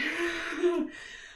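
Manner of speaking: light breathy laugh; short laugh. Real, not acted. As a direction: laugh it off briefly. Coach laughs between exercises.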